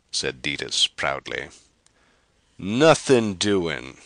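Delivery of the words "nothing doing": slow, loud, firm no; emphatic refusal. Acted. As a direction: lean hard on each word. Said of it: "Carr stated emphatically".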